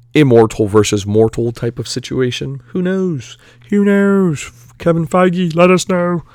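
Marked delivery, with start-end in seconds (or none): 2.7-6.2 put-on lightly done goofy accent, pitched up; calling out to Kevin Feige in a mock accent. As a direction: slip into a playful put-on accent. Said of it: "I don't know why I switched into that accent".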